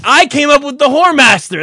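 normal talking voice

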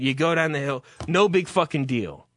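ordinary talk

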